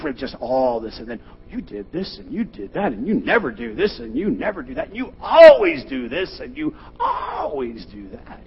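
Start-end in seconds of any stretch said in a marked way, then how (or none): 1.5-8.4 builds from raised to a high, shouted cry; escalating accusations. Retold or imitated. Mock spouse yelling blame over burnt toast, building to a shout. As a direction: pile the accusations higher and louder.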